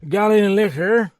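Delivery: put-on high, loud drunk asking for liquor; drunken demand. A narrator's reading. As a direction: demand a drink, sloppily. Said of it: "he demanded interestedly".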